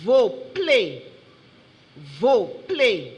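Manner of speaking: VOPLAY loud, each syllable set apart; careful dictation. Shown as a demonstration. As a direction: announce "Voplay" loudly, syllable by syllable. Spelling bee pronouncer gives the word.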